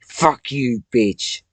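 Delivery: loud, snarled curse; furious shout. Real, not acted. Cursing Satan out of her head, furious shout. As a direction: spit it out in rage.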